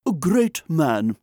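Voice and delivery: slow, loud, high pronouncement; acclaiming reviewer. A narrator's reading. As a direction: hail him slowly and grandly. Journals acclaim the writer.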